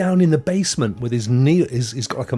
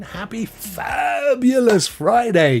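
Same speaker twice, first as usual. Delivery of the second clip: sing-song greeting; 10 semitones higher, 3 dB louder, slower than usual. Real, not acted. Greeting viewer on stream.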